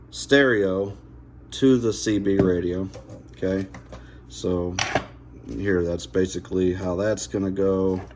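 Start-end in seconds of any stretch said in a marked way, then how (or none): none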